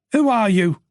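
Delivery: usual speaking voice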